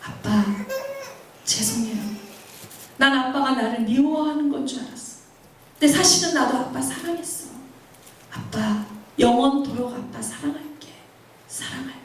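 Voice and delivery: soft, tender apology to a father, with pauses; hushed, loving confession; tearful letter reading. Acted. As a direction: read it as a daughter's farewell, fading at the end. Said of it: "마지막에 이렇게 써 있더라고요".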